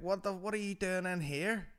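high-pitched mock street lad; spide character. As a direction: ask it like a wasted spide. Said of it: "he's like".

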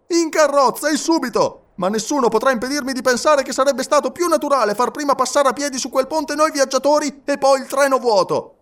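high, projected, insistent character voice; protesting Passepartout. A narrator's reading. Passepartout still insisting on his sensible idea, high protest.

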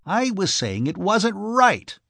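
emphatic, wanting to be heard; insistent repetition. A narrator's reading. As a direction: press the point home slowly to win attention. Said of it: "repeated Mister Blumenthal to Archie".

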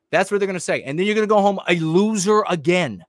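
normal talking voice

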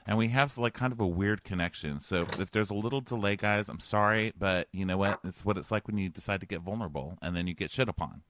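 ordinary delivery

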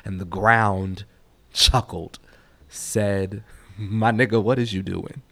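spoken-word poem, rhythmic and performed; poetry reading. Acted.

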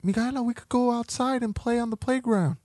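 put-on very high squeaky child voice; little girls' voices. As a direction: call her name like excited little girls. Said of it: "They tell her".

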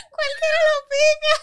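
high laughing half-cry squeal; laughing retort. Retold or imitated. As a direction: blurt it out through laughter. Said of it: "Y yo dije".

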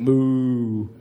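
low, loud deadpan "moo"; lazy ox moo. Acted. Preacher imitating an ox for youth. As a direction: moo like a bored ox.

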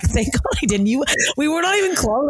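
high, relieved exclaiming; mock relief. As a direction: gush with exaggerated relief. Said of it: "I'm like".

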